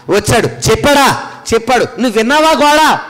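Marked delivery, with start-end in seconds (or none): 1.8-3.0 high-pitched acted interrogation; pointed courtroom question; mock judge questioning a witness. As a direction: call the witness out with a raised pitch.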